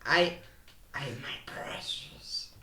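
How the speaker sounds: slow whispered creepy Gollum voice. Retold or imitated. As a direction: rasp out precious like Gollum. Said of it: "It's like Gollum".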